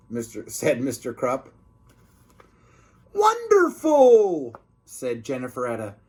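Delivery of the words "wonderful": high, slow gloating lady; higher and slow, sly cafeteria lady; drawn-out villain delight. Acted. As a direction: savour the word like a scheming witch.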